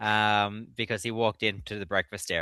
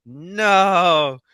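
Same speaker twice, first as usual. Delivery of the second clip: long, loud, high wail of despair; Darth Vader; 7 semitones higher, 9 dB louder than usual. Retold or imitated. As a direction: draw out an anguished movie-villain cry. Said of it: "Just like Darth Vader getting the helmet".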